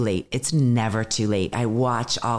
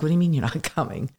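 fast, higher, laughing disbelief from the teacher; teacher scoffing at her cancelling, quick and higher; incredulous teacher; 5 semitones higher, much faster than usual. Retold or imitated. Teacher on the phone can't believe she's quitting, fast and laughing.

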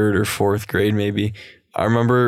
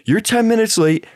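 mock scolding; 11 semitones higher than usual. Professor scolding.

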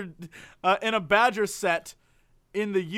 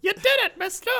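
put-on much higher, squeaky child cheer; little kids; 12 semitones higher than usual. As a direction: cheer like a small kid. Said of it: "Were the five little kids just like".

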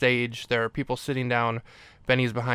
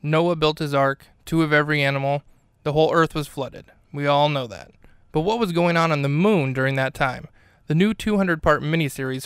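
higher, punchy promo voice; mock announcer ad read; 3 semitones higher, 4 dB louder than usual. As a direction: sell it like a grand miniseries trailer. Reads a mock sponsor promo.

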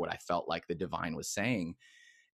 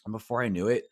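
usual speaking voice

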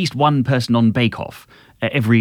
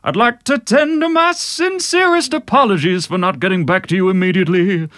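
much higher, put-on crooner voice; 7 semitones higher than usual. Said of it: "Well, I could do [name]".

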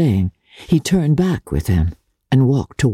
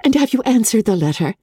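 eager cry; 10 semitones higher, faster than usual. Acted. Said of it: "cried Elizabeth".

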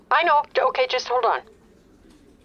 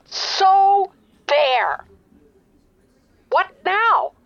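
higher and louder, fed up; exasperated outburst; 8 semitones higher, 5 dB louder, much slower than usual. Acted. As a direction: throw it back at him, exasperated. Frustrated customer snaps.

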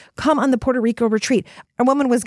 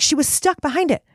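ordinary talk

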